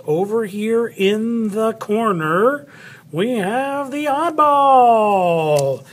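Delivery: high, drawn-out sing-out; playful announcing. Pretend. Teacher presenting the oddball in the corner, playful announcing. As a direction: stretch it out like a big playful reveal.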